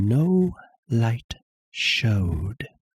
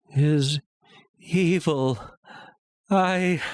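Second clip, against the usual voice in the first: building obsession; 6 semitones higher than usual. A narrator's reading. Narrator obsessing over the eye.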